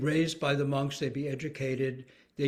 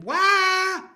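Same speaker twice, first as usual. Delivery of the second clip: sudden high loud "Wah!" of awe; storyteller's wide-eyed exclamation; gasping cry of wonder; 12 dB louder than usual. Shown as a demonstration. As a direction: burst out with a high shout of amazement. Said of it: "that "wah" is really the emotional contract".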